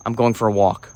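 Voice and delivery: low, joyless statement; unenthused, dropped-pitch delivery; not enjoying it. Shown as a demonstration. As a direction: say it low, wanting out. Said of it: "that I'm not enjoying".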